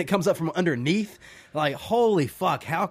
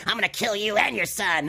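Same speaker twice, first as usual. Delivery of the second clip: mock creepy villain; 7 semitones higher than usual. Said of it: "He's not trying to be creepy".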